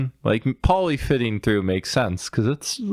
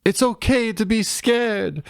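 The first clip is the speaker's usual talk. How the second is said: character quote; 10 semitones higher than usual. Retold or imitated. Acting out movie lines.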